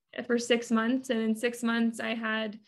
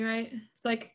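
ordinary talk

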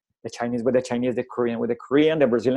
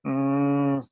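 long, level "mmm" of someone stumped; stumped hum. Retold or imitated. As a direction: hum as if imitating a blank mind. Imitates student stuck for an answer.

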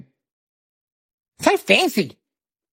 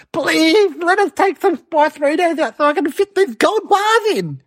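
begging; 8 semitones higher than usual. Retold or imitated. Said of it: "he was begging".

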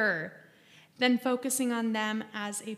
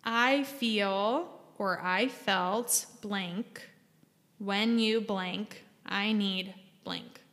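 slow template; 2 semitones lower, much slower than usual. Shown as a demonstration. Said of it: "What it looks like is you say something along the lines of".

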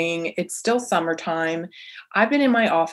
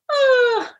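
high, open sighing "ah" on the out-breath; audible exhale; 4 dB louder than usual. Shown as a demonstration. Demonstrates a deep breath let out.

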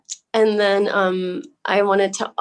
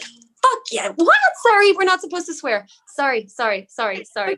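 excited; 9 semitones higher than usual. Retold or imitated. Retelling excited ladies swearing.

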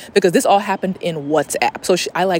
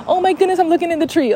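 suddenly high-pitched and thrilled; real delighted surprise; 10 semitones higher, faster than usual. Real, not acted. Sees grandparents' cardinals in the tree, real surprise. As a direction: break off in wonder, voice lifting high.

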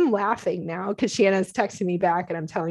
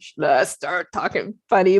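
imitating a garbled, groggy "Should I start talking funny"; slurred words; 4 dB louder than usual. Wife teases grumpy husband with his line, slurred imitation. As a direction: mush "Should I" into one blur, then "start talking funny". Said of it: "it was all messed up".

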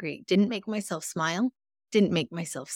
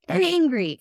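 angry burst, higher and louder; 10 semitones higher, 5 dB louder than usual. Retold or imitated. Telling a friend about Mother's Day, an angry burst. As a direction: spit out the word angry. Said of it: "I was just".

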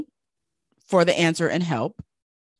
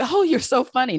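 higher, playful client brushing it off; amused client voice; 8 semitones higher than usual. Retold or imitated. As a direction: tease back lightly as the client. Client laughing off the advice.

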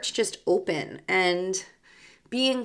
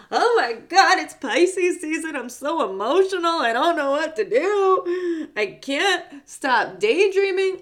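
higher and louder, swooning overdramatic whine; mocking overwhelmed Pisces; 8 semitones higher, 5 dB louder than usual. Host mocking people overwhelmed by Pisces season, dramatic whine. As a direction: lampoon people melting down over Pisces season. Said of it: "so many people are like".

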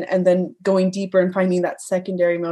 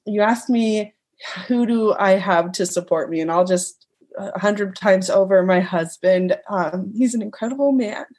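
normal talking voice

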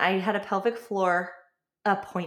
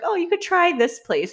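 receptionist voice; 9 semitones higher, 4 dB louder than usual. Retold or imitated.

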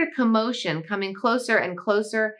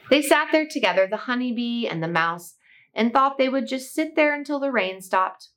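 ordinary delivery, nothing marked

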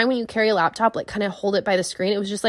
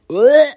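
a loud, high groan of disgust; disgusted ugh; 6 semitones higher, 8 dB louder than usual. Retold or imitated. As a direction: let out a mock groan of disgust. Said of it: "If you just went".

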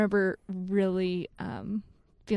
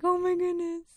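gushing; 10 semitones higher than usual. Retold or imitated. Retelling her gushing reaction.

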